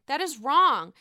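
first firm take; loud condemnation. Real, not acted. Repeated condemnation.

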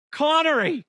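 high, exasperated cry of a name; hammed-up comic fury; mock outraged yell. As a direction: cry out as if wronged by a rival. Mock outrage at Connery impression.

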